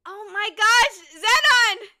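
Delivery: high, loud joyful cry of Xenon; excited cry. Real, not acted. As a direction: shout Xenon in happy recognition. Recognizing the viewer's name.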